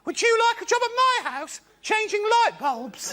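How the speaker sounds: put-on very high old lady voice, slowing down; old woman. Old woman asking a tall man to change bulbs. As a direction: ask sweetly like a little old woman. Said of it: "she asked me this question".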